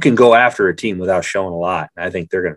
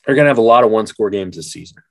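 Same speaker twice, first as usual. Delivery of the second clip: ordinary talk, nothing marked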